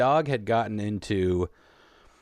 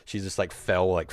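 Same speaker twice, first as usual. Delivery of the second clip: normal talking voice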